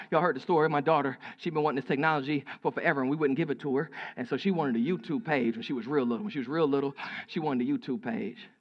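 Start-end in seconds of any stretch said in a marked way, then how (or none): none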